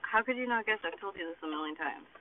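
ordinary delivery

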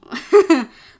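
short, loud burst of laughter; amused laugh. Real, not acted. Vlogger cracking up at her own comment, amused laugh.

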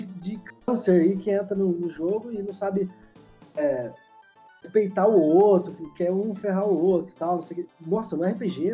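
normal talking voice

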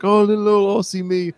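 put-on high, cutesy Australian accent; Aussie impression. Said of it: "then she pops back out and she's like".

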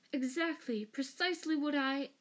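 high, eager agreement; frantic. A narrator's reading. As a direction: agree urgently, cut off midway. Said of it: "gasped Pettigrew, nodding frantically".

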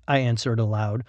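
usual speaking voice

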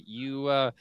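ordinary delivery

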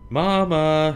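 loud, high call. Acted. Cub calling to her mother. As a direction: call her name into the woods. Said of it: "she called".

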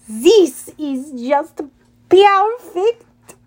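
slow drawn-out gloat, perfect jumping high. Acted. The Manticore gloats over the trapped heroes, slow gloating. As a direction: savor their trap, gloating. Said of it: "the Manticore gloated".

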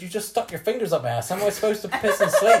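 mock outrage breaking into laughter; laughing indignation. Telling the nurse off for the exam, laughing. Retelling his protest to the nurse.

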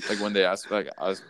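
ordinary talk

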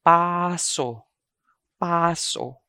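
paso as PAA-so, falling; long first syllable in paso. Shown as a demonstration. Teacher models the new word, paso with long PAA. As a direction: stretch the first vowel of "paso", let it fall. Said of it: "Another word is".

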